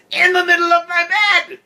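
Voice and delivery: very high, loud screech; outraged scream. A narrator's reading. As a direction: shriek it in indignant fury. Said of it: "Farrah screamed".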